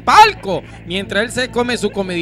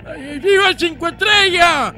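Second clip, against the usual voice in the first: put-on high drunk cheer with an ay; drunken cheer; 9 semitones higher than usual. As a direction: cheer like a tipsy fan.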